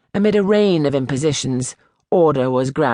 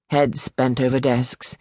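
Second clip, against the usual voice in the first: ordinary delivery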